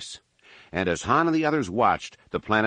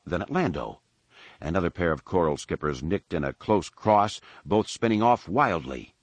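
usual speaking voice

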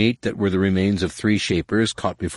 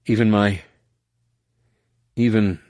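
trailing off; 2 semitones higher than usual. A narrator's reading. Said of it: "his voice trailed away".

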